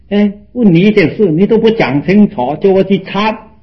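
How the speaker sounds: a disciple protesting his master, hammed-up annoyance; mock indignant complaint. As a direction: grumble at the master, voice up high and loud.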